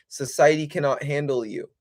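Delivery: ordinary talk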